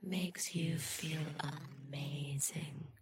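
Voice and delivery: very soft, drawn-out delivery; slow, hushed and soothing; hushed hypnotic suggestion. Acted. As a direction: let each word sink in slowly and softly. Soothing close after trigger chant.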